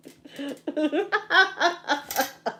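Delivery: breathy laughter fit; helpless laughing. Real, not acted. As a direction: laugh till it takes over. Retelling giggling at funeral.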